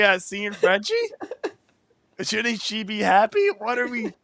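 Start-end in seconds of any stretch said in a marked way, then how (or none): 3.6-4.1 high, slow, half-laughing, half-crying; laughing disbelief. Real, not acted.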